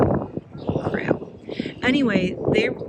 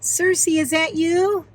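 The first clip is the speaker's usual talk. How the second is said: high calling voice; 11 semitones higher, 3 dB louder than usual. Real, not acted. Calling to her rescued turkey.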